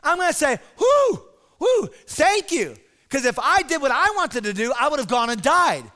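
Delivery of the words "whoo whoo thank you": high, loud whoops of relief; acted relief. Imagined Ahab thanking the man who warned him, relieved. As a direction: whoop it, then give hearty thanks.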